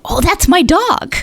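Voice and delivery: light up as if recognizing your own dog; excited claim. Retold or imitated. Friend sees the puppy and claims it, high delighted gush.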